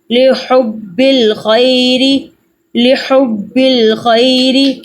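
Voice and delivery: slow, drawn-out recitation tune; slow Quran recitation. Shown as a demonstration. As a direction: recite it slowly like in class. Teacher recites the joined words. (آہستہ تجوید والی تلاوت)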